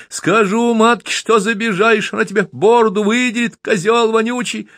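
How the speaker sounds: put-on boy caught by a drunk, high tearful threat; crying boy's threat. A narrator's reading. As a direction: threaten through rising tears.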